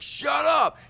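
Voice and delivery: high, loud snapped order; angry mock shout. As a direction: shut them down with a yell. Trump snaps at sons.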